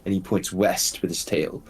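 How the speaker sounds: ordinary talk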